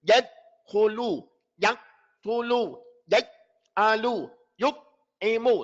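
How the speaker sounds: slow unclear stops without bounce; no qalqala. Shown as a demonstration. Teacher demos the unclear version, slow. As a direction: say them flat, no echo on the stop. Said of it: "It's not clear".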